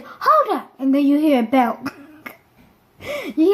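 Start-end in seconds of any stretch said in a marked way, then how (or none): 0.0-0.7 high, sudden warning call; mock angry mom. Mom about to get the belt, mock angry call. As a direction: imitate a fed-up mother calling out.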